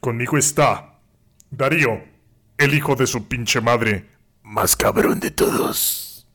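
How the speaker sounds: slow, husky presenter voice; put-on announcer voice. Host introduces co-host Darío, put-on announcer voice. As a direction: draw out a mock-grand introduction. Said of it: "voy a hacer otra voz".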